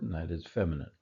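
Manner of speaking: ordinary delivery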